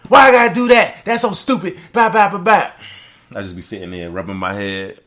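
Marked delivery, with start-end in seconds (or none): 0.1-2.7 high, loud outraged squeal; instant explosion. Retold or imitated. Coworker exploding over an assignment, shrieking high and loud. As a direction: blow up like a coworker given a task. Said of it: "Instant explosion".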